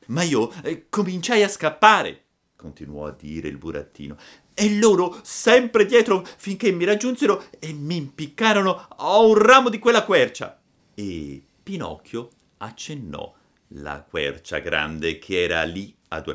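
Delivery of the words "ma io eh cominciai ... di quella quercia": high, loud puppet voice; a boy reliving his fright; puppet retelling. Acted. Pinocchio recounts the hanging. (burattino racconta l'impiccagione, voce alta)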